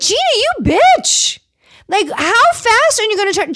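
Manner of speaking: high-pitched mock outrage; outraged reaction. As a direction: blurt it way up high, scandalised. Retells own outraged reaction to Gina.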